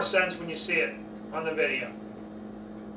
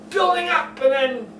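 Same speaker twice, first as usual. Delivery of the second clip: building up; 7 semitones higher, 4 dB louder than usual. Shown as a demonstration. Coach demonstrating a build in pitch. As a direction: voice pushed much higher and louder.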